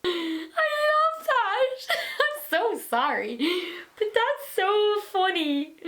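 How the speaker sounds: high, squealing laughter through the words; crying with laughter at the story; laughing hard. Real, not acted. Laughing at the curtain story, high and squealing.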